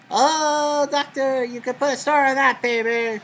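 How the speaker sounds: loud, high, thrilled shout of a home run; excited home run call. Acted. Announcer calling a home run for McGriff, loud and thrilled. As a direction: belt it out like the ball just left the park.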